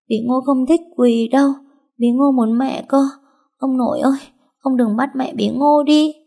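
put-on high little girl's voice, delirious and whining; a sick child pleading in her fever dream; whimpering feverish child. A narrator's reading. Feverish little girl whimpering to her mother in delirium, high childlike whining. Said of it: "Con cứ nắm tay tôi mếu máo".

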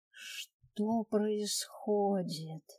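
very slow, dazed and soft; drugged daze. Acted. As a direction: drag it out, drugged and foggy. Drugged narrator's dazed thought.

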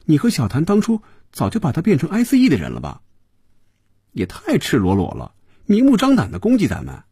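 high-pitched, insistent pouty protest; pouting. A narrator's reading. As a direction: pout and press your boss for an answer, pitched high. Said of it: "菲比一撅嘴说". (撅着嘴追问抱怨，声调高)

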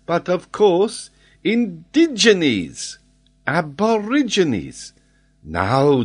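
put-on high, loud French-accented exposition; triumphant French geographer. Geographer proves his reading to Glenarvan, triumphant. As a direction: draw out each key word emphatically. Voices Paganel arguing his case.

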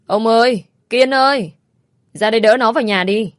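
loud, high panicked calls; calling out. Acted. Mother calling husband and son to carry her son, loud panicked cry. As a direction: yell for help across the house. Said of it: "bà la hét cái gì đấy".

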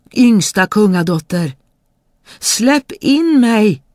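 high, loud insistent call; the frog loudly reminds the princess of her promise. Acted. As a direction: demand to be let in. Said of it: "grodan ropade".

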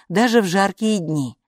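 ordinary delivery

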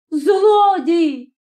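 high-pitched cry of rage; accusing yell, voice pitched high; furious cry. A narrator's reading. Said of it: "крикнула з люті".

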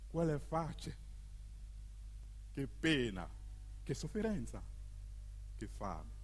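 low, soft and sinking lament; hushed lament. Acted. Narrator pitying workers left at home, hushed lament.

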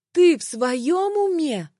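slow, high-pitched indignant question; indignant disbelief. A narrator's reading. Woman challenges her jealous accuser, slow and incredulous. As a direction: draw it out, pitch swooping up.

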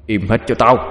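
mildly shrill, forceful command; shrill shout. A narrator's reading. Possessed Lâm silencing his friends from above, shrill. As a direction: snap it out as an order. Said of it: "giọng the thé lên".

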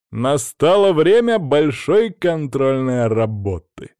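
loud, drawn-out announcement; ringing, stretched-out call; exclamation. A narrator's reading. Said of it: "воскликнул я".

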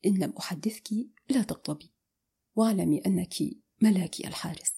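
soft, understated amusement; lightly amused. A narrator's reading. He teases her over the phone, softly amused. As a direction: say it low-key with a hint of laughter. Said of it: "فقال ضاحكا".

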